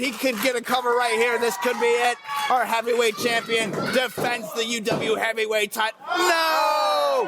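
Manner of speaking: excited, shouting play-by-play; wrestling commentator. Acted. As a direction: call the match live, yelling. Live play-by-play.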